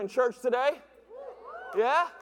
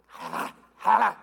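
mock villain laugh; 2 semitones lower, 5 dB louder, slower than usual. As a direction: cackle like a cartoon villain. Evil laugh over salty batter.

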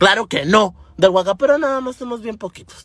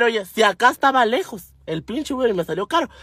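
ordinary delivery

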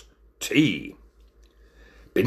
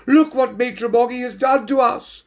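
high, outraged lady voice; shrill shouting; 4 dB louder than usual. A narrator's reading. Lady complains of the waiter's mess, shouting high. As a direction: cry out in high-pitched indignation. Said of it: "the lady shouted".

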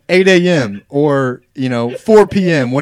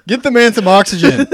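laughing; 8 semitones higher, 3 dB louder than usual. Real, not acted. Everyone laughing hard.

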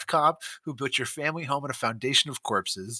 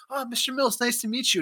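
higher, polite and eager; nervous boyfriend; 9 semitones higher, 3 dB quieter than usual. Retold or imitated. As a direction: play a timid boy meeting the dad. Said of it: "the boyfriend's like".

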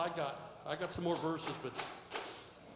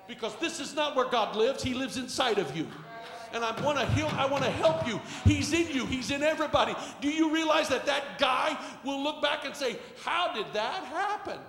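raised, urgent pulpit voice; full-force preaching; 11 semitones higher, 10 dB louder than usual. Real, not acted. Preacher telling the church God lives inside them, urgent. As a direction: press the point on every listener.

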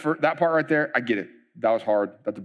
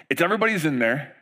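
ordinary talk, nothing marked

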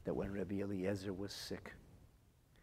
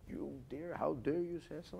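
indignant retort; 6 semitones higher than usual. Retold or imitated. Rich man's indignant retort.

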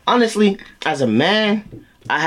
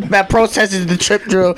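normal talking voice

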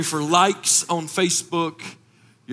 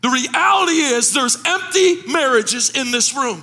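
full-force preaching; 7 semitones higher than usual. Acted. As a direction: preach it to the rafters.

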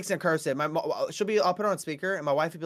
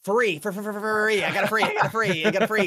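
stuttered robbery demand; 6 semitones higher, 4 dB louder than usual. Retold or imitated. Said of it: "Pulls out a gun".

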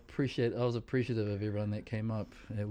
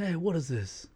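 put-on Italian-American mafia accent, pitched up; pushy mafia don wanting more tea; Godfather voice; 6 semitones higher than usual. Patient in the ward demanding more tea, mock Godfather accent. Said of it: "He's like".

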